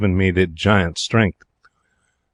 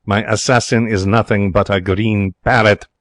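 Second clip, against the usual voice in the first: ordinary delivery, nothing marked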